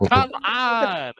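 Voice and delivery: fan's excited come on. Real, not acted. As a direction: cheer it like a fan in the crowd. Hyped reaction to the heel turn.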